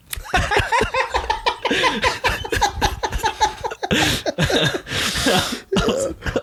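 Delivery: long squealing laugh; long high laugh. Real, not acted. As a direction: break into high-pitched laughter.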